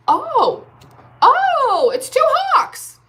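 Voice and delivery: high, delighted gasps at the hawks; seeing two hawks out the window, thrilled; excited gasp. Real, not acted. Tarot reader spots hawks out window, high thrilled gasp.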